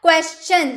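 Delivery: क्वेश्चन as kwes-chun, neither "shun" nor "tun"; क्वेश्चन ending in a clear "chun"; correct CH pronunciation. Shown as a demonstration. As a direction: say क्वेश्चन with "ch" after the s. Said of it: "क्या?".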